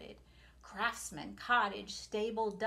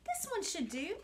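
a hint of pleased Ma voice; 9 semitones higher than usual. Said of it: "said Ma".

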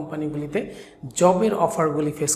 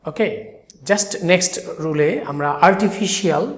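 usual speaking voice

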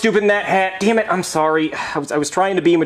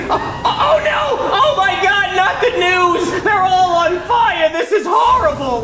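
horrified wail; 11 semitones higher, slower than usual. Acted. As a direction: wail in horror as they burn. News crew catches fire.

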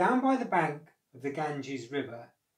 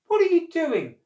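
high, loud puzzled shout; calling up; 12 dB louder than usual. A narrator's reading. Crocodile waiting below the tree, calling up puzzled. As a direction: call it up into the treetop.

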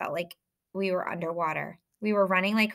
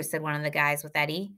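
ordinary delivery, nothing marked

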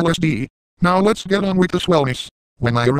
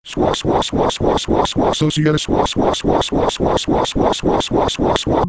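1 whispered swa chant; slower than usual. Pretend. Spell cast before transformation.

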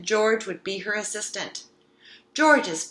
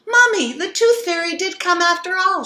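much louder and higher, jumping with joy; excited shout; 10 semitones higher, 12 dB louder than usual. Acted. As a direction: shout the good news to Mummy. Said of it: "she shouted".

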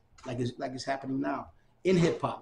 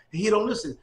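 ordinary talk, nothing marked

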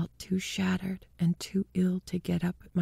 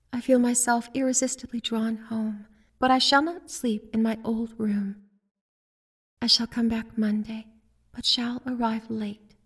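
lightly higher, fast letter voice; letter read aloud; 7 semitones higher than usual. A narrator's reading. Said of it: "I read".

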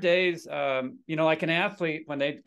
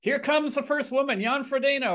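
lightly hammed-up race-announcer call, pitched higher; mock announcer; 8 semitones higher than usual. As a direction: play an announcer calling the wrong name.

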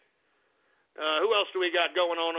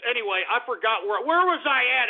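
ordinary delivery

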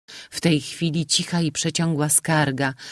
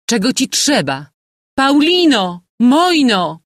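much higher, louder frantic call; 10 semitones higher, 8 dB louder than usual. A narrator's reading. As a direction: shout for the maid and daughter. Mother calls for help.

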